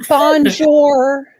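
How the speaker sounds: loud, high bonjour in a bad accent; terrible French accent. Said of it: "bonjour in your terrible accent".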